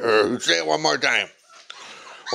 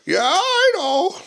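much higher, drawn-out coo; slower than usual. Real, not acted. Owner cooing high and slow at her dog.

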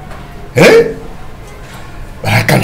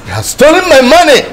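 loud, high, outraged shout; angry outcry; 9 dB louder than usual. Acted. Father raging to daughter about stolen money, loud outcry. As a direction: cry out at being robbed.

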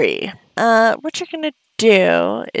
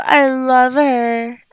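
ordinary delivery

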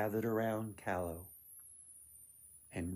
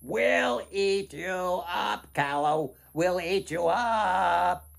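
high, loud, slow goblin threat; goblins crying out; 7 semitones higher, 8 dB louder, slower than usual. Acted. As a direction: goblins circling the girl, gloating. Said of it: "they surrounded the girl and cried".

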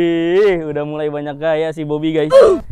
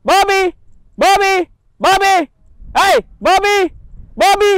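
high, loud repeated calls; shouting the bird's name; 7 dB louder than usual. Real, not acted. Owner yelling for his bird flying too far, high and loud. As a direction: yell him back again and again. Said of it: "saya pasti teriak-teriak manggil dia".

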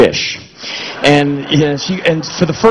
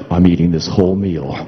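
much lower, firm command; override voice; 11 semitones lower than usual. Acted. Narrator overriding his body, much lower and firm. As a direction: decree it like a robot override.

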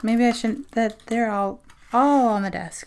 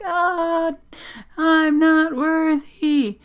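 loud, high, drawn-out overawed cry; worshipful mock wail; 6 semitones higher, 5 dB louder, much slower than usual. Fan overwhelmed by loveliness, hammed-up unworthy wail. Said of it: "and I'm just like".

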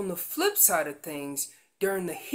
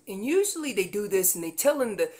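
normal talking voice

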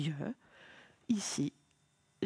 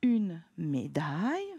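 slow reading; 3 semitones lower, slower than usual. Shown as a demonstration. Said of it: "très bien".

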